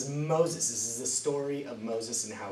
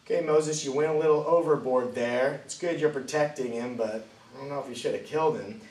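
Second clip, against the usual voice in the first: mock scolding; 4 semitones higher, 7 dB louder than usual. Teller scolds Moses mockingly.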